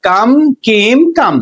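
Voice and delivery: ordinary talk, nothing marked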